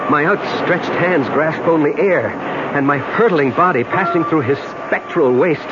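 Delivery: narration climbing in pitch to a climax; dramatic story narration. Acted. Man narrates his plunge to death, mounting intensity. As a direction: build steadily towards the final words.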